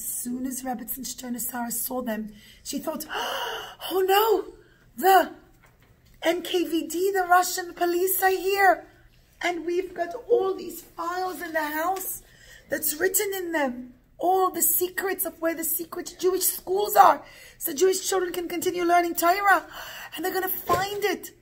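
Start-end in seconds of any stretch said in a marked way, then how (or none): 3.1-3.9 sharp intake of breath in fright; alarmed gasp. Acted. Old mother catches sight of the police, gasping in alarm. 3.9-21.3 higher, louder alarmed storytelling voice; very scared. Acted. Old mother dreads police finding the secret files, scared. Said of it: "she got very scared".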